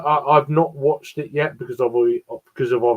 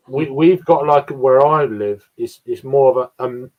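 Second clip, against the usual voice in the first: ordinary delivery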